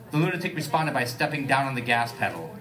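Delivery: ordinary talk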